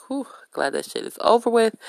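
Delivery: mock relieved sigh of a line. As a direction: let the tension go on the whew. Quoting own relief after dispute.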